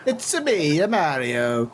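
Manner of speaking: put-on Mario-style Italian accent. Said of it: "in your Italian accent in your head".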